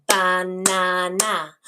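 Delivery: "banana" very slow, ba, na, na; syllable segmenting. Shown as a demonstration. Teacher repeats clapped syllables, "banana" stretched into three beats. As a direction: give each syllable of "banana" an equal, level beat. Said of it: "its syllables, like this".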